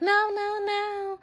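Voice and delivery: very high, drawn-out wail without volume; mock screaming. Friend begging on the phone, hammed-up high wail. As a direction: imitate her overdramatic screaming, held back. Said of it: "She's screaming and shit on the phone".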